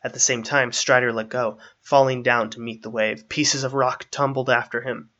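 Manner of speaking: ordinary delivery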